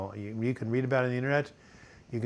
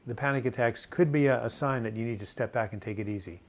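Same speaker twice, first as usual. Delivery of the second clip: ordinary talk, nothing marked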